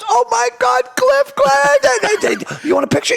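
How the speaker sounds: very high, squealing mom impression; hammed-up starstruck squeal; starstruck mother. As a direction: imitate a thrilled fan in a high falsetto. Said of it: "And she goes".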